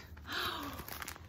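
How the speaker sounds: soft, breath-only gasp; soft delighted gasp. Real, not acted. Collector spotting honeycomb ornaments, soft delighted gasp.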